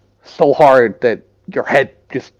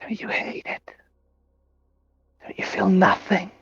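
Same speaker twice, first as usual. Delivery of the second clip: whispering; 5 semitones higher, 9 dB quieter than usual. Acted. Entity taunting in a whisper.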